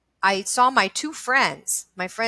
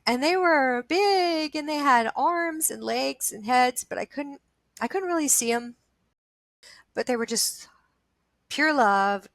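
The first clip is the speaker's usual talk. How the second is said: real near tears; 5 semitones higher than usual. Real, not acted. Describing the light beings from her vision, near tears. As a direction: keep talking while holding back tears. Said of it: "I'm gonna cry".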